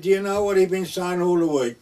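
high, loud voice of the farmer's wife; loud retelling. Retold or imitated. Farmer's wife telling the vicar about her husband, loud and high. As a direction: tell the vicar loudly over the bed.

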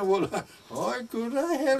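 high-pitched, spluttering astonishment; flustered disbelief. Retold or imitated. Friend realising his mistake in the shallot judging, flustered. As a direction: splutter it out as the penny drops.

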